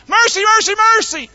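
high, loud triple cry; mock crying out. Preacher acting what angels never said, high mock shout. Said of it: "They cried out".

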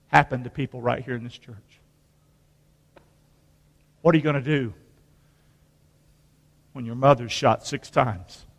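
soft and low, telling his own loss; hushed grief. Real, not acted. Preacher telling the church about his mother's killing, hushed. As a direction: speak it quietly, like it still hurts.

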